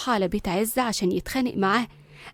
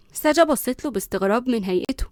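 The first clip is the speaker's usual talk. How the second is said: ordinary delivery, nothing marked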